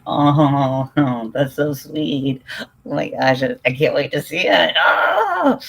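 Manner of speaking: loud, high, laughing delighted squeals; can't contain the excitement; overexcited. Real, not acted. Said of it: "Okay, I need to chill out".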